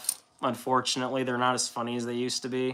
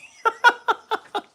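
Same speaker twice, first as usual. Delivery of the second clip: a shrieking high burst of laughter; 6 dB louder than usual. Real, not acted. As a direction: cackle high and loud. Laughing at own retelling.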